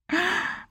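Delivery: high sudden gasp of shock; sharp gasp. Real, not acted. Moved by the verse she just read, a real gasp. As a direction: catch your breath in dismay.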